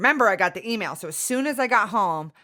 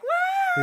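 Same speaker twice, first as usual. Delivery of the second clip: long, very high "woo"; high sustained cry of cartoon panic; mock panicked wail. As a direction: squeal like everyone's freaking out. Imitates panicking emotions holding hands.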